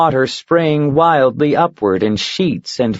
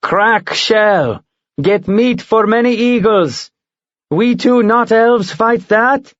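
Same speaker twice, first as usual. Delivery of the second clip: higher, eager broken-English bird; hungry for the fight; eager giant eagle; 9 semitones higher, slower than usual. Acted. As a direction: eagle eager to attack the bug.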